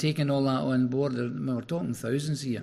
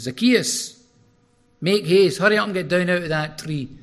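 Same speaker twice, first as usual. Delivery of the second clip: loud, higher call up into the tree; 6 semitones higher, 8 dB louder than usual. Retold or imitated. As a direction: shout his name up to the man in the tree. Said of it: "he's shouting your name up".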